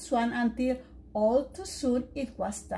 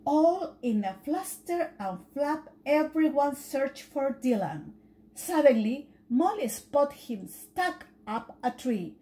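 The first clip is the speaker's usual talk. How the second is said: usual speaking voice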